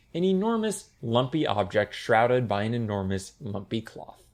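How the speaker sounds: usual speaking voice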